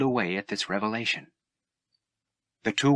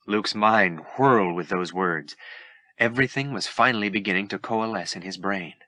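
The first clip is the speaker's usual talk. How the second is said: plain reading voice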